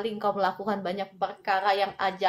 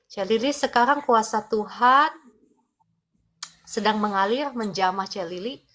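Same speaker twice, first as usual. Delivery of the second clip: fervent healing prayer. Acted. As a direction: pray with rising force, pressing in. Healing prayer over a viewer. (doa penyembuhan yang berapi-api)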